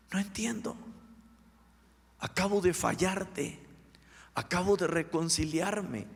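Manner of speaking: high, pleading retold cry to God; tearful plea. Preacher retelling his prayer on his knees, tearful pleading. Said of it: "le dije a Dios llorando".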